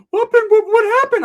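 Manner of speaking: very high, loud squeaky falsetto; hammed-up cartoon bewilderment, way up high; [name]. Imitating bewildered cousin, high falsetto.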